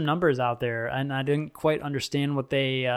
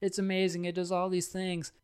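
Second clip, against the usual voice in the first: higher, softer imitation of someone selling; a hint of a salesperson, pitched up and kept quiet; eager gadget pitch; 4 semitones higher, 8 dB quieter than usual. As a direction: lightly mimic a friend talking up a new phone. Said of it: "attempting to, like, sell me on the Note eight".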